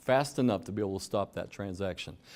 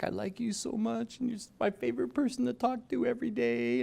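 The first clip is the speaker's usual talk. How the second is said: higher, sugary flattery from a romance scammer; sweet-talking scammer; 11 semitones higher, 4 dB quieter than usual. Retold or imitated. Romance scammer sweet-talking a lonely older adult, mock tender and higher. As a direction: coo it like a con artist reeling someone in.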